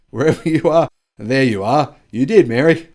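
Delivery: Joe Biden. Retold or imitated. Host quoting the president saluting GM's boss, mock-oratorical. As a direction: lightly imitate a speaker hailing someone in the crowd. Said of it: "amplified by U S President Joe Biden".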